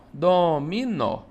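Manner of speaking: slow "dominó" as DO, MI, NÓ; syllable separation. Shown as a demonstration. Teacher repeating the separation, slow "dominó" syllable by syllable. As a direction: split "dominó" into three drawn-out syllables. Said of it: "Separação de dominó".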